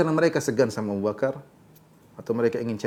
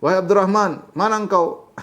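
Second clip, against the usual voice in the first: calling out higher and louder for Abdurrahman; retold shout; 5 semitones higher, 4 dB louder than usual. Abu Bakar calling for his hiding son, raised shout. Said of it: "Abu Bakar berteriak".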